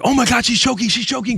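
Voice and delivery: high, urgent retold panic; alarmed cry. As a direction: imitate a mother suddenly raising the alarm. Mother's alarm retold in a crisis.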